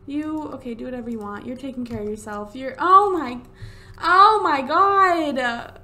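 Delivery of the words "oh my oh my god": loud, drawn-out, high exclamation; loud cry of shock; shocked dismay. Real, not acted. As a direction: wail it slowly in disbelief. Shocked by the pet's death.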